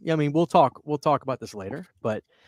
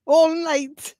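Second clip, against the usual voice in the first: high, loud, drawn-out mock Irish-Jamaican; hammed-up Irish-gone-Jamaican echo, held long and high; mock Irish-Jamaican accent; 12 semitones higher, 5 dB louder than usual. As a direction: parody a botched Irish accent, pitched way up. Echoes the accented commercial just played.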